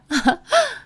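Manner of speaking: short, high scornful laugh; high mocking titter. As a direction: laugh derisively at someone's expense. Sister-in-law mocking on the phone.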